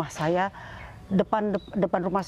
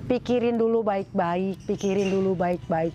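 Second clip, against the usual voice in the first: slow, deliberate retold advice; imitating someone giving careful counsel; measured advice; slower than usual. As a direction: say it slowly, as an elder counselling caution. Retelling the official's measured advice.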